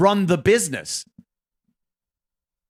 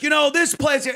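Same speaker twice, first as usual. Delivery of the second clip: mock angry cohost; 10 semitones higher than usual. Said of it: "and then be like".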